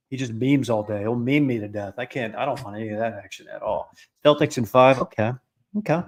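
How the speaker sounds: ordinary talk, nothing marked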